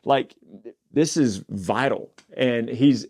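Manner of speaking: ordinary talk, nothing marked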